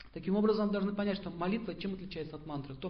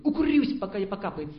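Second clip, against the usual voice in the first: defiant shout; 7 dB louder than usual. Acted. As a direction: shout it like a binge. Acted smoker's defiant binge.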